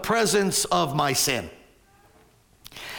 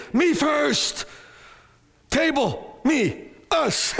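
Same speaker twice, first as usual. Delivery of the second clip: selfish grabbing; 7 semitones higher, much slower than usual. Retold or imitated. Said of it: "causes me to say".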